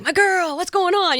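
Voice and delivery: bright, high-pitched upbeat boss; a pumped-up boss greeting his girl; high energy. Retold or imitated. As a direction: pitch it up like an excited mentor. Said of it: "he's, like, this high energy guy".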